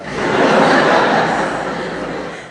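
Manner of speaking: short breathy laugh; unvoiced chuckle burst; real laugh. Real, not acted. Laughs at daughter's answer.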